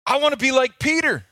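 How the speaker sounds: put-on high, loud and fast, like an eager child; eager kid. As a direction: burst out wanting to be Peter. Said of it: "I'm like".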